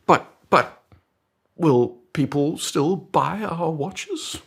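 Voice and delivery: high, stretched-out anxious protest; worried underling. Acted. Colleague doubting boss about customers, high hammed-up worry. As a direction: stammer a worried question, pitched high.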